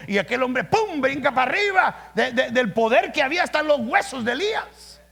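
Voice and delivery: loud, high, driving sermon voice; fiery preaching. Acted. Pastor thrilling the church with Elisha's miracle, fiery preaching. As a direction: build the miracle story with fire.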